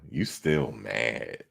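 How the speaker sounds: slow, soft, low teasing read; quoting a viewer's taunt, slow and low; mock taunting drawl. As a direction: drag it out like a smug taunt. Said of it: "He said".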